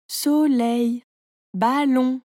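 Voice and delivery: "soleil" and "ballon" split into two syllables each. A narrator's reading. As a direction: stretch so-leil, then ba-llon. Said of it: "tu coupes le mot en petites parties".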